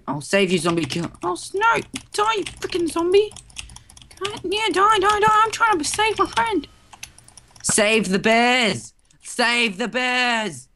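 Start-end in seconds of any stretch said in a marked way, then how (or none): 1.2-6.7 very high frantic squeal. Real, not acted. Gamer fights a zombie to save his friend, high frantic voice. 7.6-10.7 high, long-drawn rallying cry; battle cry. Pretend. Gamer charges in to save a friend, mock battle cry. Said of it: "that's my battle cry".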